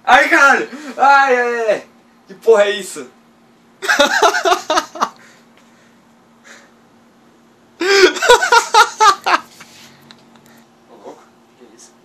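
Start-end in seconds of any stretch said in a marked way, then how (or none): 0.0-3.1 screaming in real terror; scared horror game streamer. Real, not acted. 3.1-10.8 long shaky relieved laugh; nervous laughter. Real, not acted. Relief after jump scare.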